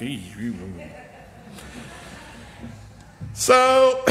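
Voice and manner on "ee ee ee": imitating a soft dry gag, very low and quiet; quiet mock gag sounds; gagging noise. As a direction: mimic a retching gag under the breath. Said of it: "you'd be like".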